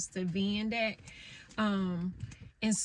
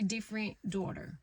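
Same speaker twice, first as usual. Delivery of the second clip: usual speaking voice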